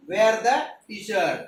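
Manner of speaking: very slow, drawn out; slow teaching drill. Shown as a demonstration. Teacher modelling the phrase slowly for pupils. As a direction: give each word its own beat. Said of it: "T-shirt घाल".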